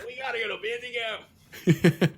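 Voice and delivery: high, loud and slow cartoon Rick; loud high Rick impression; Rick-style yell. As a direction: drag it out like a drunk cartoon scientist. Friend echoes the Rick voice.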